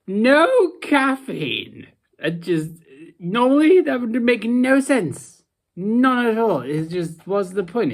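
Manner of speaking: high, loud protest at the very idea; exasperated disbelief. Real, not acted. Tea sceptic objecting to pointless decaf, exasperated. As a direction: raise the pitch and exclaim in disbelief. Ranting that decaf makes no sense.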